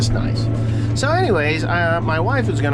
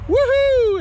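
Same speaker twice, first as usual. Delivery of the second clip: whoop. Real, not acted. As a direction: cut loose with a joyful holler. Cheering as tire goes.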